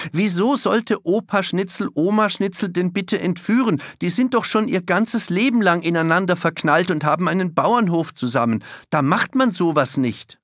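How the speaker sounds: put-on indignant girl Tanne. A narrator's reading. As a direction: defend the grandparents with disbelief. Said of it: "„Ihr spinnt".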